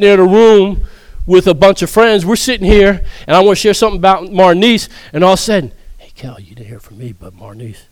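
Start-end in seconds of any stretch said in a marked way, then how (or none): 6.0-7.8 whispered behind someone's back; sneaky hushed gossip. Shown as a demonstration. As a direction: drop to a secret whisper. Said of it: "Why don't I say it loud enough".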